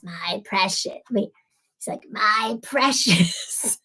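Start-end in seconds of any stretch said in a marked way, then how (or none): 0.0-1.0 low, soft creature imitation; half-voiced creepy quote, low and soft. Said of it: "This really creepy voice". 2.1-3.8 very slow, creepy creature voice; stretched, hammed-up creepy creature. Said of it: "This really creepy voice".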